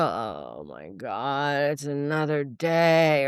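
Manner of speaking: imitating a morning dread sigh; mock dread. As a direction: drag it out like a weary grump. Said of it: "I don't go".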